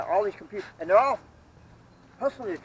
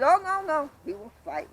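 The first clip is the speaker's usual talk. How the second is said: high excited taunt; 12 semitones higher, 4 dB louder than usual. Retold or imitated. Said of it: "I said".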